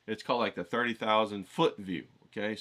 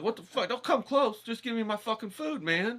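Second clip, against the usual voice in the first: raven griping, pitched way up; mock raven voice; 12 semitones higher than usual. Ravens grumbling at the host for food, high mock voice. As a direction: squawk it like a cranky bird wanting food. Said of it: "they hobble like".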